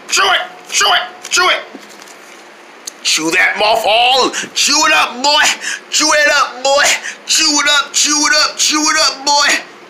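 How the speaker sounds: high chanted orders; yelling. Acted. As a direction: bark chew it up. Yelling chew it up.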